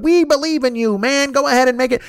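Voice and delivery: high-pitched gushing supporter; mock cheerleading. Fans urging Artie on despite everything, mock cheerleading. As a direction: cheer him on in a high, pleading imitation.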